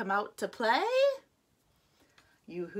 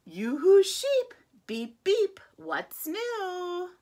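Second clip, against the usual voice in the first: calling out; 9 semitones higher, 6 dB louder than usual. Acted. Truck calls to the sheep.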